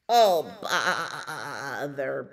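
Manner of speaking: long quavering bleat through the word; bleating sheep. A narrator's reading. Sheep turned out of horse's bed, drawn-out bleat. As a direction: stretch it into a slow, pulsing baa. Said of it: "bleated sheep".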